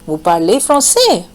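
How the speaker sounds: "Vous parlez français" asked with "français" lifted sharply in pitch; question intonation. Shown as a demonstration. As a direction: ask "Vous parlez français" by tone alone, peaking on "français". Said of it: "The first form is intonation".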